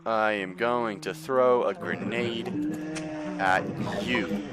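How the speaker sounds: slow, measured words; deliberate announcement. Real, not acted. Gamer to game enemy, slow deliberate threat. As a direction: spell out the threat slowly, pausing before the end.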